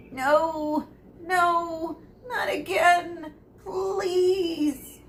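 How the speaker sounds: high, tearful pleading; frightened pleading. A narrator's reading. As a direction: beg as if on the verge of tears. Quirrell pleading, being threatened.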